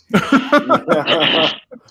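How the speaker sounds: real burst of laughter. Real, not acted. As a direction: laugh it off heartily for a moment. Laughs after missing his window.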